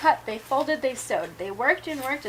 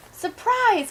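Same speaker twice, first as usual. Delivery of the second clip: happy surprise; 9 semitones higher, 5 dB louder than usual. Acted. Mice revealing the dress.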